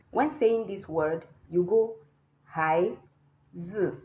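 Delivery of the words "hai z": "hai z" with a short, toneless z; neutral tone. Shown as a demonstration. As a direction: cut the z off abruptly after hai. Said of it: "It sounded like I stopped talking abruptly".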